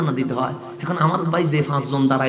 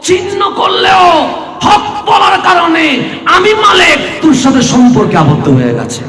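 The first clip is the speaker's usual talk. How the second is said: high, loud, ringing sermon cry; full-force waz preaching shout; fiery preaching; 12 semitones higher, 8 dB louder than usual. Acted. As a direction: preach it at the top of your voice, stretching the quote.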